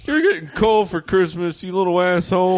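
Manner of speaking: hammed-up furious Christmas tree; angry tree. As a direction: yell back like an insulted tree. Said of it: "Christmas tree".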